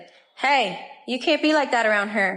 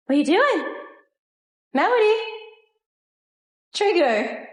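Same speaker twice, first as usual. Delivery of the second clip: doggy voice; 6 semitones higher than usual. Real, not acted. Owner greeting her excited dog in a high pet voice. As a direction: gush at the puppy.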